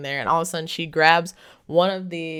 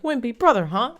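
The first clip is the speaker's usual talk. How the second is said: higher, louder, smug payback taunt; taunting jab; 8 semitones higher, 4 dB louder than usual. Retold or imitated. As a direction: throw his own words back at him. McGregor taunts Frank before punching.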